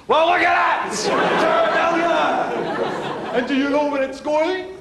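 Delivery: high, loud gloating crow; to the teller who refused the overdraft, a high triumphant gloat. Pretend.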